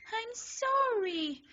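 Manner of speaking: very high, loud, drawn-out shriek; slow, very high, loud shriek; panicked yell. Acted. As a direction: scream the apology in panic. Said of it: "you yell".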